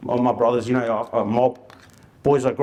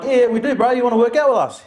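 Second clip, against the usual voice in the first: mock confident; 9 semitones higher, 3 dB louder than usual. Acting confident to young fella.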